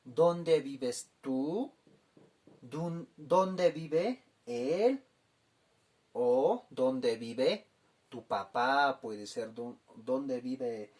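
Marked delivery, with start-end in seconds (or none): 0.0-7.7 slow, deliberate question for learners; careful classroom pace; slow, clear teaching. Shown as a demonstration. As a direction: spell it out slowly for the class. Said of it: "Vamos a aprender a preguntar".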